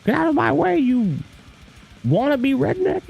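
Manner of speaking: put-on high crotchety old lady voice. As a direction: shoo him off like a feisty old lady. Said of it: "if they did it like".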